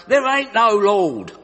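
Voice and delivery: loud, drawn-out indignant shout; angry shout. Acted. Angry atheist neighbour answering the lady's proclamations, shouting. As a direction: holler back crossly, pitched high. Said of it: "get so angry at her proclamations he would shout".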